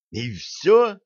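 high rising disbelief; incredulous short query; surprised question. A narrator's reading. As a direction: ask in astonishment, pitch jumping up. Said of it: "удивился Грег".

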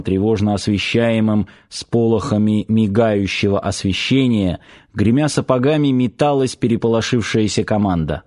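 usual speaking voice

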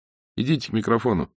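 ordinary delivery

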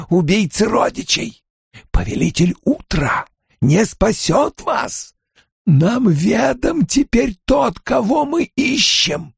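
high, slow menacing ancient voice; Aginor. A narrator's reading. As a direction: gloat slowly like an ancient villain. Aginor threatens the heroes.

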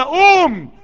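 high, loud قوم; rally the crowd to rise; crowd-rousing cry; shouted refrain. Acted.

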